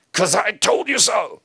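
hammed-up "because I said so" parent; mock bossy parent. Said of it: "he doesn't just say a boundary like".

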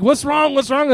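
imitated wife, high anxious questions; high-pitched mock alarm. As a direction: fret like a wife startled awake. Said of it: "she'd be like".

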